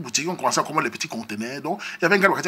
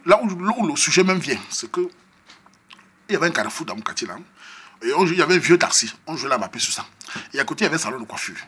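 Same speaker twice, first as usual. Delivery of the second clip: ordinary talk, nothing marked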